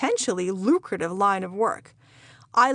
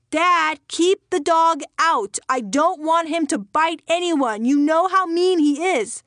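loud, high-pitched yell; 6 semitones higher, 5 dB louder than usual. Acted. Girl calling to imaginary dad as she enters the house, yelling. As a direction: shout it out for all the house to hear. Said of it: "I yelled".

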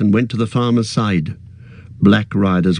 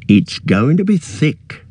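slow, higher farmer's voice; farmer Maggot; 6 semitones higher, slower than usual. A narrator's reading. Farmer eyes the mist and speaks slowly. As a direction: weigh the fog warily, slowly. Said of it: "said Maggot".

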